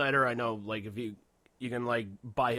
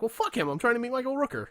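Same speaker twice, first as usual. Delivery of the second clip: mock indignant; 12 semitones higher, 4 dB louder than usual. Retold annoyed reply to friend.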